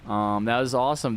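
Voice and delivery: ordinary delivery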